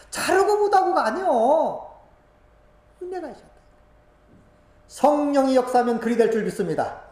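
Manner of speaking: loud, high preaching with a cry; tearful preaching. Real, not acted. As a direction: preach through tears. Preaching with tears.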